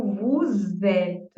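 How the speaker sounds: "vous êtes" linked as vu-zet, slow; liaison with Z. Shown as a demonstration. Teacher shows the liaison of vous êtes. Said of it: "Vou usar esse S aqui com som de Z".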